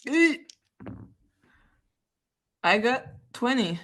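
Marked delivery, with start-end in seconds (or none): 0.1-0.5 high startled eek; frightened squeak. Real, not acted.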